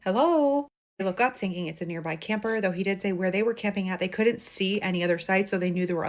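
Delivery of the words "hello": put-on small, high child calling out; little girl voice. A narrator's reading. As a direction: call like a lost little girl. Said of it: "a little voice coming from the woods".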